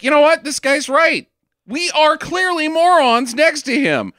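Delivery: high-pitched, emphatic mock concession; mock NASA staff. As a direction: play over-eager officials conceding, high and loud. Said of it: "And, and they're like".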